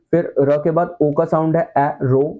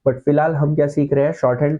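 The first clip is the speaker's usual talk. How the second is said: usual speaking voice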